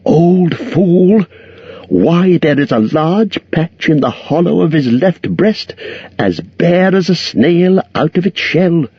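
high hobbit voice, privately scornful; Bilbo's inner thought. A narrator's reading. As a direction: think it to himself with contempt. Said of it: "what he thought inside was".

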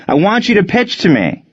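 high-pitched, indignant protest; mad yell. A narrator's reading. Batter protests an intentional walk, mad yell. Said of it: "he yelled".

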